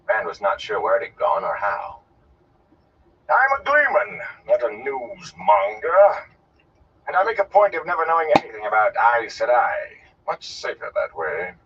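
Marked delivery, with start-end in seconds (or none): 3.3-11.5 high, emphatic theatrical protest; indignant gleeman. Acted. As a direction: declare your trade with offended grandeur. Indignant gleeman rebuffs questions.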